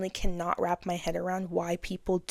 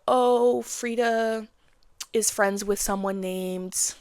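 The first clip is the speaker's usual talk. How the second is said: ordinary talk, nothing marked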